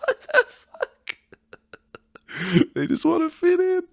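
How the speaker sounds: squealing high through laughter; words squeaked out mid-laugh; cracking up, voice leaping way up. Real, not acted. Cracking up at own joke.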